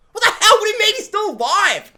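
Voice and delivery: mock outraged screech; incredulous shout. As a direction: shriek your disbelief. Speaker's own retold outburst.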